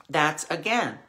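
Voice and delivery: normal talking voice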